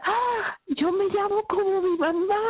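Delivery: high, gasped "ah" into proud joy; proud delight. Retold or imitated. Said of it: "yo estaba así bien orgullosa".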